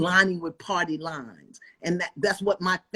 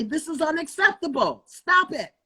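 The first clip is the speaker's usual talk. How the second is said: firm shout; 9 semitones higher than usual. Retold or imitated. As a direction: demand it with full force. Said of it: "grab your balls up, and say".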